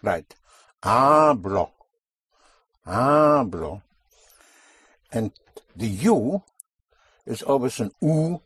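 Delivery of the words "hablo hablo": Hablo with a long, pushed-down "HAAH"; drawn-out "HAAH-blo"; stress before last syllable. Shown as a demonstration. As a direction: lean hard on the first syllable of Hablo, short clear "o". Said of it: "push down on the syllable before last".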